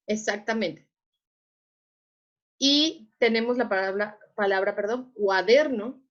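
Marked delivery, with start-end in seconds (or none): 5.1-6.0 "cuaderno" with DER louder and leaned on; cua-DER-no, punch the middle syllable; stress on DER. Shown as a demonstration. Teacher presenting a word for students, stress on DER in "cuaderno". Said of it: "En dónde está la sílaba tónica".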